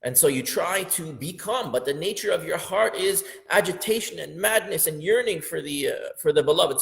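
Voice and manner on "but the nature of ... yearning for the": usual speaking voice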